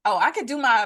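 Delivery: usual speaking voice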